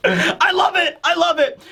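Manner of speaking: high-pitched giddy exclamation; tickled and elated; real delight. Real, not acted. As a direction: gush with glee after laughing. Delighted after laughing fit.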